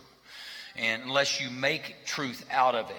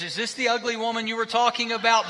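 put-on higher and louder, a blurting little kid; mock child's voice; 8 semitones higher, 4 dB louder than usual. Pastor imitating a child at Target, high and loud. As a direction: blurt it out like a tactless toddler. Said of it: "and the baby goes".

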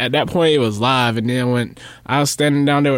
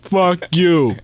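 higher mock-angry brush-off; imitating drunk outburst; 4 semitones higher than usual. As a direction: act out a drunk friend refusing to explain. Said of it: "he bipolar as hell".

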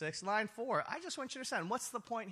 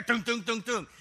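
knocking noise; 4 semitones higher, 8 dB louder than usual. Acted. Imitating knocking on door.

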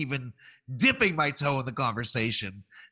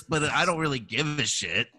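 ordinary talk, nothing marked

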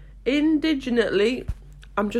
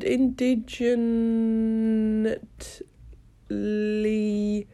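"Indignantly" drawn out extremely slowly, syllable by syllable; sounding out slowly; much slower than usual. Real, not acted. Googling an unknown word, sounding "Indignantly" out very slowly. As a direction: stretch "Indignantly" as if typing it out.

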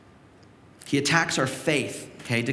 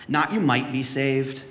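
ordinary talk

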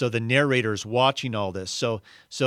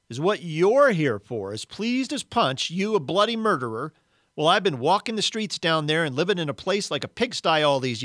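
resentful ghost rant; 5 semitones higher than usual. Acted. Big Ghost rants.

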